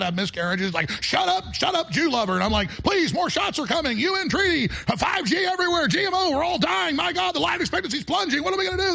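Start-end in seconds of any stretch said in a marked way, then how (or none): 1.0-2.3 high mock yell; mock heckler. Imitating hecklers yelling at him. 2.8-8.9 high, shouted frantic pleading; mock panic. Imitating his own panicked warnings.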